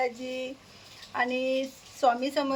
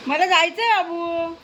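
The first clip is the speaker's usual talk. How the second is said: raised, higher pleading call; urging call; 5 semitones higher, 6 dB louder than usual. Real, not acted. Hurries child along.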